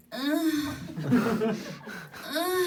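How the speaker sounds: mock moans of a friend, high; groaning sounds done high and loud; imitated moaning. Mimicking her friend's moans in the night, high groans. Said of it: "a sound that, um, was like".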